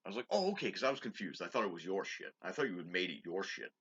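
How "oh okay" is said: sarcastic. Retold or imitated. Sarcastic retold reply.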